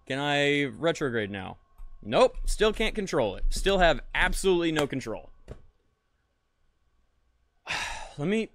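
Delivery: normal talking voice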